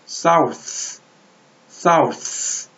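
사우스 as south, th set apart at the end; separate final th. Shown as a demonstration. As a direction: say 사우스 slowly, lingering on the final th. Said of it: "따로 발음하셔야 돼요".

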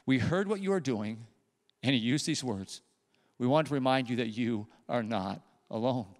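soft, low, gentle encouragement; tender reassurance. Retold or imitated. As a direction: hush it down, kind and sincere. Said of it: "He said".

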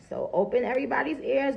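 ordinary delivery

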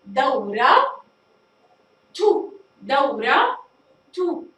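دورة تو said slowly, the final ة sounded as a clear tu; taa marbuta voiced. Shown as a demonstration. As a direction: stretch out دورة تو, separating the tu. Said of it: "ونلاحظ بنطقها". (نطق دورة تو ببطء مع إظهار التاء المضمومة)

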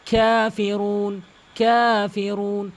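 Kafirun chanted slowly; slow recited Kafirun; slow recitation. Shown as a demonstration. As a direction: draw out Kafirun like Quran reading. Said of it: "আমি যেভাবে বলছি সেভাবে".

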